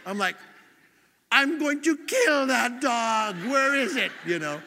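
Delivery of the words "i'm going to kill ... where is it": loud, high, slow hammed-up threat; mad. Sleepless man at a barking dog outside, hammed-up fury. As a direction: stretch out an exasperated mock-furious yell. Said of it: "I mean, I get mad".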